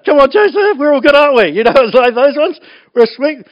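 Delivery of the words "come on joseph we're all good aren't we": high, louder pleading coax; coaxing brothers. Retold or imitated. Brothers sweet-talking Joseph, coaxing, higher and louder. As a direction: wheedle him into agreeing.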